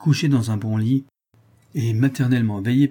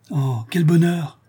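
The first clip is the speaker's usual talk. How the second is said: high, drawn-out cry of joy; delighted exclamation; 7 semitones higher, 4 dB louder, slower than usual. A narrator's reading. Children learn they are leaving the island, delighted cry. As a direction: exclaim it slowly, like a happy child. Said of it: "s'écrièrent les deux enfants".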